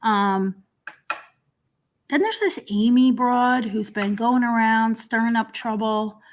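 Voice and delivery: normal talking voice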